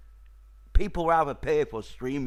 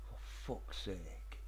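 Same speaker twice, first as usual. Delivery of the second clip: ordinary delivery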